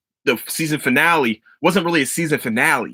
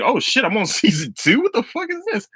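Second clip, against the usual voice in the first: rising to a much higher startled question; surprised; 9 semitones higher than usual. Retold or imitated. Finding himself already on season two, a high surprised exclaim. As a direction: sound caught off guard, climbing up high. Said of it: "I was like".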